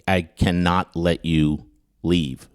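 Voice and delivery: slow, deliberate retold refusal; measured mock warning; slow ominous insistence. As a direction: space the words out, mock-grave.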